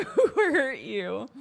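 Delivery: laughing quip. Real, not acted. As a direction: tease through laughter.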